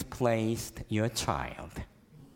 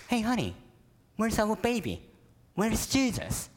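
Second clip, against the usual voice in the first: higher, anxious wife asking for her son; worried Mary; 7 semitones higher than usual. Acted. Mary asks her husband where Jesus is, worried. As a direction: call to him, alarm rising.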